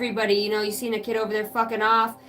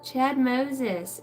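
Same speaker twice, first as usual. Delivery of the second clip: ordinary talk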